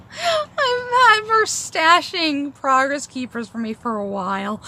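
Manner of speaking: talking through real laughter; laughing. Real, not acted. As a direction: laugh while you say it. Laughing about hoarded keepers.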